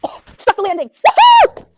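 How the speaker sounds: high, loud, thrilled whoop; excited cheering. Real, not acted. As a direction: cheer the jump with joy. Cheering horse's jump.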